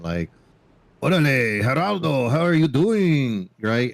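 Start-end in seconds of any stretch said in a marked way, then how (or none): 1.0-3.5 put-on a light Mexican accent, a bit louder, slowing on the last line. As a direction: greet like a Mexican cook, in a mild Mexican accent. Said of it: "super Mexican".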